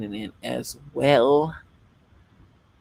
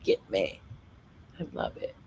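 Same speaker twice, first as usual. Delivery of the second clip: ordinary talk, nothing marked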